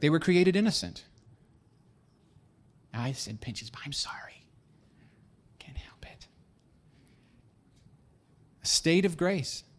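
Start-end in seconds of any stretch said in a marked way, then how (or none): 2.9-6.3 very soft, fading into a whisper; hushed, low confession to the room; whispered aside. Real, not acted. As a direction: whisper a sheepish apology under the breath. Muttered aside apologising for a joke.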